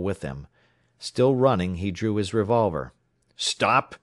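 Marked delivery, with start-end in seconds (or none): 3.4-4.0 raised, louder command; ordering. A narrator's reading. Runner with drawn revolver orders the riders to halt, commanding. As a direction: order them sharply to halt. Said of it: "he ordered".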